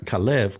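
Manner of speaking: Kalev with the accent on the second syllable; stress on second syllable. Shown as a demonstration. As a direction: lean on the last syllable of Kalev. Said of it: "the accent on the second syllable".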